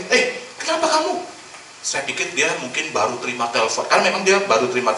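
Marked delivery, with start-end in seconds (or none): 0.1-1.2 high, startled "kenapa kamu"; startled question. Retold or imitated. Pastor retelling how he asked the crying clerk, startled and high. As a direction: ask in sudden alarm. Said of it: "Saya juga kaget".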